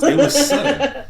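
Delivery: 笑いながら高い声で; laughing. Real, not acted. Laughing while telling scary moment.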